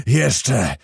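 mild narrated cry in an altered voice; cried out. A narrator's reading. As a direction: call out a brief, contained demand. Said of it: "po czym zakrzyknął".

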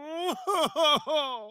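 a light high giggle, amused at himself; high amused laugh. Acted. Vampire laughs at his own flirting, high and light. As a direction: laugh off your own line, high and airy.

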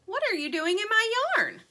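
high, loud annoyed question. A narrator's reading. Dirt annoyed at Sugar in her yarn, sharp question. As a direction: snap at the chick in your yarn.

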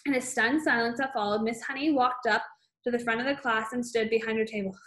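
ordinary delivery, nothing marked

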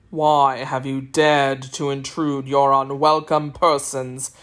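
a cold ruler demanding answers, deep and unhurried; deep, deliberate sorcerer interrogating the children; stern sorcerer. Acted. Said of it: "he asked sternly".

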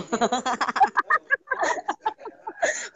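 long, high-pitched laughter. Real, not acted. Laughing during the banter. (cười dài, cao giọng)

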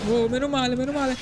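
high, breathless relief; sudden high-pitched relief; relieved squeal. Real, not acted. Relief after near death in game.

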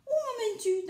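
high-pitched anxious little creature voice; storybook victim's high question; turtle asking. A narrator's reading. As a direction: ask it high, like captured prey. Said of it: "demanda Tortue".

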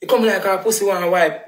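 ordinary talk, nothing marked

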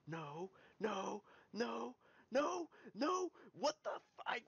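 rising, high-pitched outraged "no"; frustrated cries. Real, not acted. Gamer watching the spell fail, frustrated cries.

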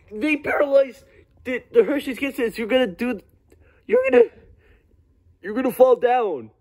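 high, stammering alarm; panicked warning. Acted. Warning a friend the candy paralyzes, frantic and high. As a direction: stumble over the words in a high panic.

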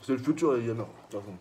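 talking while chewing; biting a burger. Real, not acted. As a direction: mouth full of food. Seen 2: Burger held at mouth, biting through 2.8; pulled away, chewing after.

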